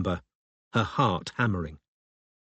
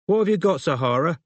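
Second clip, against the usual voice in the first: high, raised London mum's voice; louder, pitched-up questioning mother; woman calling from background; 7 semitones higher, 4 dB louder than usual. A narrator's reading. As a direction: call across the room to a child. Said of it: "a woman's muffled voice said".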